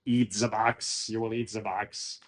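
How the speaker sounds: put-on slow heavy German accent. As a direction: imitate a German accent, slowly. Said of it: "So he says with his German accent".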